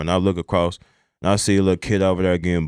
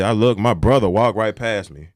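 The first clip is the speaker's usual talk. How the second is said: usual speaking voice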